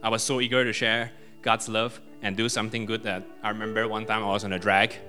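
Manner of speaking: ordinary delivery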